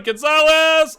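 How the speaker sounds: loud, high yell of the name; frustrated loud cry at the player; shouting a name. Real, not acted. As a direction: bellow the name across the pitch. Yelling at player's run.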